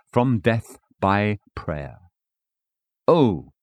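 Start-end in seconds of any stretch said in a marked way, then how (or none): none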